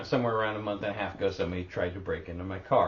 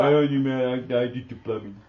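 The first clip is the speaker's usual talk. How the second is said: joking dumb guy; 3 semitones higher, 6 dB louder than usual. Pretend.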